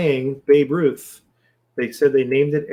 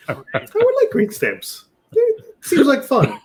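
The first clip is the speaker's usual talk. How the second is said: mock eager; 11 semitones higher than usual. Joking about green stamps.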